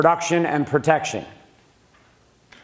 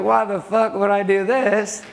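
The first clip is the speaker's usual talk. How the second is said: higher, slower, drawn-out indignation; indignant complaint; 6 semitones higher, slower than usual. Retold or imitated. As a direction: lean into the outrage. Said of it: "I was like".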